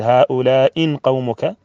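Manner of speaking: slow "هؤلاء قومك", haa-ulaa-in with a clear n before qawmuka; with tanween. Shown as a demonstration. Teacher giving an example, "هؤلاء" slowly with nunation. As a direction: draw out "هؤلاء" and end it in -in. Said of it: "أن تقول أولئك بالتنوين".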